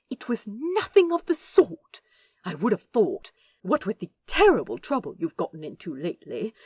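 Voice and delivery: loud, high, hurried protest; flustered prim angel. Acted. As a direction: babble a denial, panicking.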